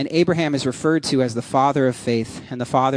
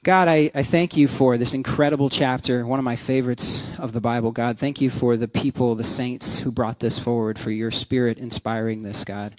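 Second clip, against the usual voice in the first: soft, lowered praying voice; spoken prayer; 4 semitones lower, 4 dB quieter than usual. Real, not acted. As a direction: lead a congregation quietly in prayer. Leads congregation in prayer.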